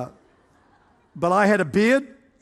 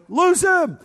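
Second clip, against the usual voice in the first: loud, high commanding shout; yelling; 13 semitones higher, 5 dB louder than usual. Retold or imitated. Elders casting out a demon with hands on his head, yelling. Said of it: "then they started yelling".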